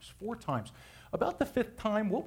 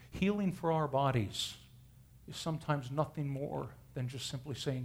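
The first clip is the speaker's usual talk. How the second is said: normal talking voice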